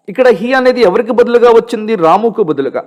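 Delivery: ordinary talk, nothing marked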